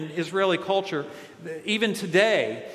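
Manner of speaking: normal talking voice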